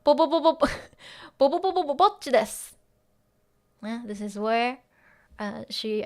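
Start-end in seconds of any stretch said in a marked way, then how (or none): 0.0-2.8 high, fast, stammering bo bo bo bo. Retold or imitated. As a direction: stutter it out nervously and quickly. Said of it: "she goes like".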